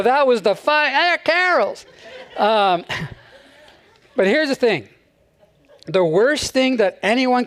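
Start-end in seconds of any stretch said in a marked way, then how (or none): 0.0-1.9 high, giggly retelling of the joke; mocking listener. Congregation member retelling the joke on the drive home, high and amused. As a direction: imitate someone fumbling the punchline on the way home. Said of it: "You're gonna go".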